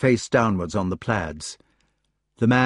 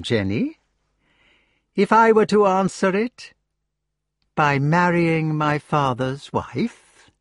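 sneering Mordred; 7 semitones higher than usual. Acted. Mordred reveals incest.

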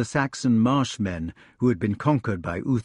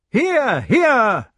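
high, loud calling out; urgent cry; 12 semitones higher, 6 dB louder than usual. A narrator's reading. Lancelot riding at the galloping pair, crying out. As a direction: call out sharply to riders at a distance. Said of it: "cried Lancelot".

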